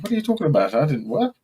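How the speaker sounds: high, baffled what are you talking about. Retold or imitated. A queuer baffled by a stranger's question, high and confused. Retold own confused reply.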